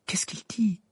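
high, eager question; breathless Hermione. A narrator's reading. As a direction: ask as if short of breath. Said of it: "d'un ton haletant". (d'un ton haletant)

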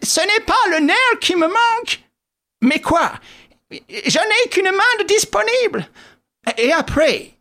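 high-pitched squeaky companion; thin high character voice; Wilson, injured sidekick. Acted. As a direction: pipe up in a high, put-on voice.

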